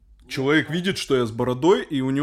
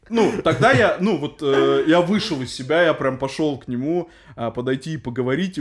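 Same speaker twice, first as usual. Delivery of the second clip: usual speaking voice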